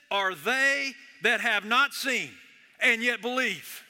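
slow emphatic pulpit proclamation. Acted. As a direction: proclaim it deliberately. Jesus's blessing proclaimed slowly.

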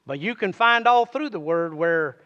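hushed earnest sermon delivery; soft intense preaching. Real, not acted. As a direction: draw the congregation in quietly.

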